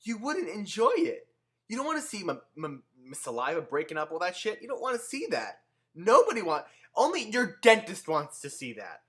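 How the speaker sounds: heated rant. Real, not acted. Ranting at open-mouth chewers.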